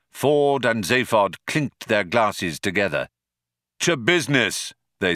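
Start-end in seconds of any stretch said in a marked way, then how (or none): none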